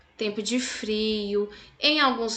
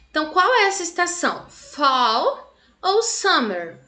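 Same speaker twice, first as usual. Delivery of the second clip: quiz question; 4 semitones higher than usual. Shown as a demonstration. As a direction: stretch out Fall ou spring like a quiz. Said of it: "teacher quiz question to kids".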